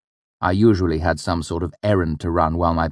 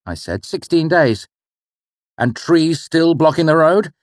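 an exasperated father, louder and higher; irritated rant; 5 semitones higher, 4 dB louder than usual. Acted. The father complaining to his son about the blocked King's Road, exasperated.

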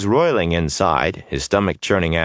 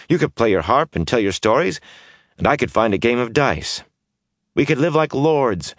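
put-on higher, eager young rogue voice; brash young man; 5 semitones higher than usual. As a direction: restless youth pleading to leave. Mat's voice in story.

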